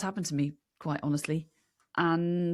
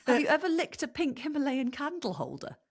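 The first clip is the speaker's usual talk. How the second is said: higher-pitched mock-astonished repeat; incredulous echo; 7 semitones higher than usual. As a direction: echo the bizarre question in amused disbelief. Echoes the odd question in disbelief.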